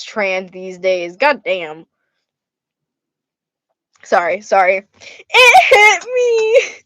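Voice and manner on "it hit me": loud, high, drawn-out mock wail; whiny crying kid. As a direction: wail like a tattling child, hammed up. Re-enacts crying brother tattling.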